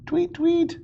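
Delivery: high chirpy whistle sound; whistle imitation. A narrator's reading. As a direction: mimic a police whistle.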